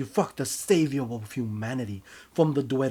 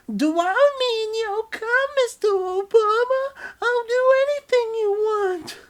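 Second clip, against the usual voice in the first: high, loud, crying falsetto plea; begging lover; 12 dB louder than usual. Acted. The lover pleading with you in a high crying falsetto. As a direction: beg desperately, almost sobbing.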